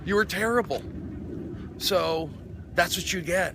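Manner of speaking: snapping back at the heckler, half acted; retold telling-off. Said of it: "I go".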